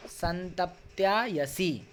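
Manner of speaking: "संतप्त्यायसी" said slowly as one word, every syllable clear; slow careful reading. Shown as a demonstration. Teacher to students, reading a Sanskrit word slowly. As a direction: read "संतप्त्यायसी" slowly so students can copy. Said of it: "एक एक शब्द को पढ़ रहा हूँ".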